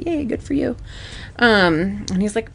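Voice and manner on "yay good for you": high, mock-bright sarcastic yay; sarcastic cheer. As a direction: fake enthusiasm, lightly mocking. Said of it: "And I was like".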